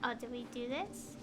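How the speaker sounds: ordinary delivery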